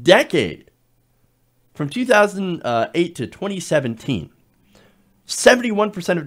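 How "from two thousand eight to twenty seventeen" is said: ordinary talk, nothing marked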